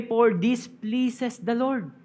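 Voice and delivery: preacher reading the verse aloud to his congregation; scripture reading. Acted. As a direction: proclaim the verse to the church. Rereads verse.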